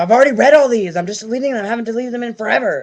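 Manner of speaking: mock his loud annoyed reply; imitating boyfriend's outburst. Retelling her boyfriend's snappy answer, loud mock outburst. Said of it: "He's like".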